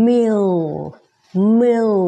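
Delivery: "meal" with its L ending like "เอา"; slow model "meal", final L sounded. Shown as a demonstration. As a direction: glide "meal" into a dark L. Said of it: "meal ก็มี เอา".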